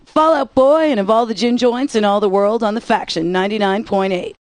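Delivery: ordinary delivery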